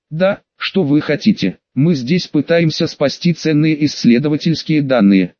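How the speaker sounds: plain reading voice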